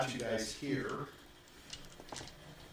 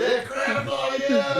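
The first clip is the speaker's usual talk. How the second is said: loud, high raucous jeer; rowdy shouting; 11 semitones higher, 8 dB louder, much slower than usual. Acted. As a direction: holler it like a rowdy bro. Rowdy brothers chanting and punching.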